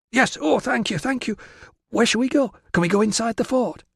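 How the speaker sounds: put-on very high, excited girlish gush; thrilled gratitude. Virana thanks Tilla for letting her stay, thrilled. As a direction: burst out thanking, then pester eagerly.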